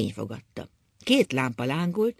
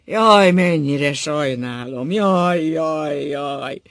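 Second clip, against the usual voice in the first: loud, slow howling lament; loud wail fading at the last "jaj"; howling grief; 9 dB louder, much slower than usual. A narrator's reading. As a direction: howl out the pain, fading. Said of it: "Szinte csaholta keservét".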